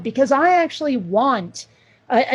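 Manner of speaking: ordinary talk, nothing marked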